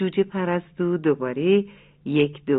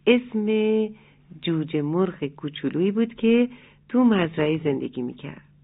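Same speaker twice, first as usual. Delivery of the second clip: ordinary delivery, nothing marked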